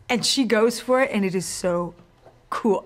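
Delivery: ordinary talk, nothing marked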